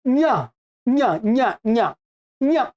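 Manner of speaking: "न्य" as short, separate palatal-nasal syllables; palatal nasal demo. Shown as a demonstration. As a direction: repeat "न्य" with tongue at the palate, sound through the nose. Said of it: "इसका उच्चारण क्या होगा". ("न्य" का तालव्य नासिक्य उच्चारण)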